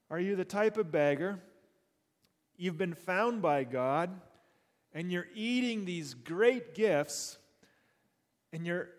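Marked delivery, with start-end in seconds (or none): none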